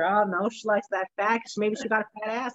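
ordinary talk

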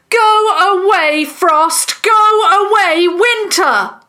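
high, loud banishing shout; forceful chanted yell; loudest voice. A narrator's reading. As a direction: banish them at full voice. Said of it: "summoned the loudest voice that she could".

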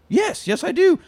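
high, rising insistence; insistent. Retold or imitated. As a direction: dig in and insist, pitch going up. Nicholas insisting in retold scene.

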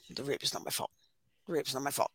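flat, quiet, detached recital; dispassionate. Retold or imitated. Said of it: "just very dispassionate".

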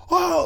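very high-pitched mock scream; imitated scream. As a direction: squeal out a thin, high "oh". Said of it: "like, fucking screaming and shit".

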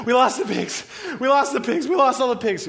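loud, high, frantic cry; panicked report. Acted. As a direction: blurt out the disaster, acting frantic. Herders report disaster in panic.

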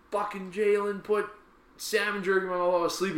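high-pitched acted grievance; retold indignant complaint. Complaining to coach about Jalen, high indignant retelling. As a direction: replay tattling on a teammate to coach.

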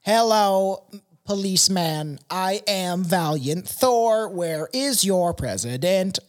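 slow, stiff alien visitor voice. Retold or imitated. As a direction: announce yourself like a calm spaceman. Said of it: "That's how I feel like he talks like".

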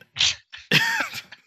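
high-pitched burst of laughter; squeaky laughing fit; real laugh. Real, not acted. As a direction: crack up, high and breathy. Laughs after teasing apology.

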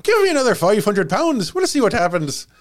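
a hint of an Irish builder; mock contractor. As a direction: play the chancer tradesman. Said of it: "contractor complaints".

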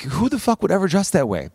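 high-pitched mock disbelief; incredulous onlooker. Viewer reacting to a runway outfit, incredulous. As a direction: act out a baffled viewer, pitched well up.

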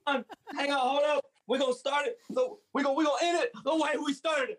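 high, barking drill sergeant commands. Acted. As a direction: shout it like a hyped drill instructor. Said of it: "do it in a drill sergeant voice".

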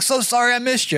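high, earnest sorry imitating a friend; eager apology. Greg apologizing to Paul for missing him, high eager voice. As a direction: lift it up, apologetic and keen. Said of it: "he's like".

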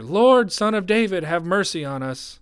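high, loud, urgent plea. A narrator's reading. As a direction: cry out at the top, easing toward the end. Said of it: "they only shouted louder".

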